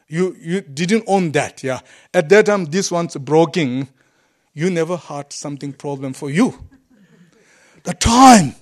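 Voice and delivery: ordinary talk, nothing marked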